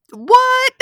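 high, loud shriek of disbelief; incredulous outburst. Real, not acted. Disbelief at Viper's callous remark.

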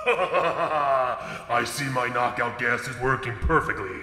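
slow, menacing villain voice; creepy voice. Acted. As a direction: drawl it out like a sinister kidnapper. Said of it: "creepy voice man".

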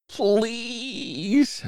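high, drawn-out plea; begging. A narrator's reading. Bird pleading with the reluctant elephant, high begging. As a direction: beg in a very high, stretched voice. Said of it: "begged the bird".

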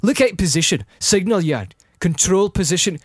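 emphatic Scottish-accented reading; Scottish accent narration. Shown as a demonstration. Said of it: "try to narrate in a Scottish accent".

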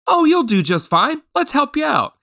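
high-pitched, folksy offer of help; kindly grandpa. Retold or imitated. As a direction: reassure a nervous beginner like a grandpa. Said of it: "perfect grandpa tone".

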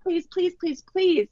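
mock pleading kids, pitched high; imitated kids pleading with mom; begging kids. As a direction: beg like boys who lost Legoland. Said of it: "they're crying and begging her".

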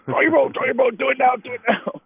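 high urgent silent plea; pleading mental urging. Retold or imitated. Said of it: "mental E S P to say".

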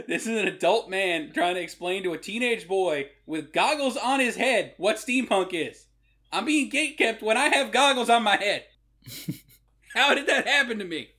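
mock outrage. Retelling being gatekept with goggles on, mock outrage. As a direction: protest it in exasperated disbelief.

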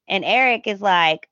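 ordinary talk, nothing marked

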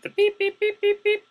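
high, loud beep-beep imitation; imitated machine beeps. Copier jamming at Kinko's, high beeps. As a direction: chirp like a copier alarm.

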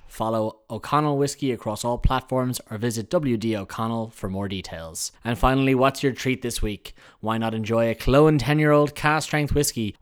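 upbeat sponsor read. Acted. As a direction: sell it like a radio ad. Host reads sponsor messages.

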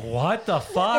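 stunned what the fuck; shocked disbelief. Retold or imitated. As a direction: drag it out in disbelief. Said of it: "I literally was like".

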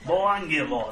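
put-on light Jamaican accent on Mon. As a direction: drawl Mon Jamaican style. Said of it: "use my Jamaican accent".

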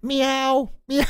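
high-pitched little cat meow; kitty meow. Shown as a demonstration. Coach recalling the class exercise, high squeaky meowing. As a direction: mew like a tiny kitten. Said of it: "I had everybody go".

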